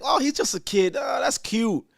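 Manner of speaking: high cooing parent voice; doting parent. Retold or imitated. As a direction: coo like a parent charmed by a naughty kid. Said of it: "We're not supposed to say".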